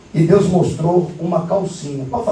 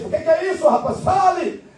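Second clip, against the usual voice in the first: exasperated demand; 8 semitones higher than usual. Retold or imitated. Pastor presses the man.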